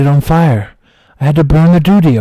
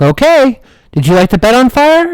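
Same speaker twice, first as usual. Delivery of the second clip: much higher, coaxing fireman; higher firefighter voice coaxing a child; 10 semitones higher, 3 dB louder than usual. Retold or imitated. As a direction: sweet-talk the kid into confessing. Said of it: "They're like".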